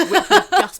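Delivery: laughing loudly through the words. Real, not acted. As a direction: get the line out between laughs. Laughing while making her point.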